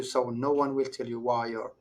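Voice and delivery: normal talking voice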